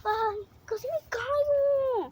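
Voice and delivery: high, loud, slow frightened cry; real fright. Real, not acted. Nearly falling in the garden, frightened high cry. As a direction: gasp out the near fall. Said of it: "me dio un susto".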